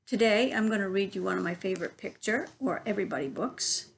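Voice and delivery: ordinary delivery, nothing marked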